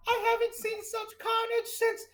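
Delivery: high, theatrical veteran rat voice; a shaken old war veteran rat recalling carnage; the rat Ratson tells the party, in a high put-on voice; old soldier rat. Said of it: "Ratson goes".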